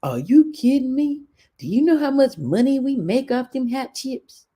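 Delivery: acting out the indignant principal; quoted scolding principal. Principal scolding a teacher over chips, mock outrage.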